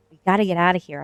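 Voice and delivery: a contained yell within story narration; narrated yell. A narrator's reading. Narrator calls to Trevor to flee, contained yell. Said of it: "I yelled to Trevor".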